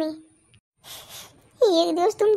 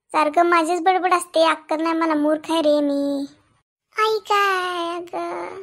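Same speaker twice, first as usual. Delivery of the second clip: ordinary talk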